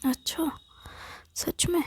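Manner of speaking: soft, high questioning lilt; mildly surprised, coy question; pleased surprise. Acted. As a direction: ask as if half disbelieving. Wife reacts to husband's offer.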